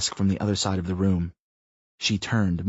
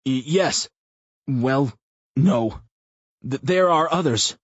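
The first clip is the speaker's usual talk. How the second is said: nervous stammer; 5 semitones higher, 4 dB louder, slower than usual. A narrator's reading. Stammering captured man.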